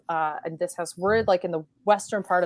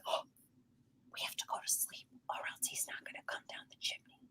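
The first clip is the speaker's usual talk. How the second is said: put-on urgent hushed kid whisper; 20 dB quieter, faster than usual. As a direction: whisper fast so Santa won't hear. Kids afraid Santa hears them.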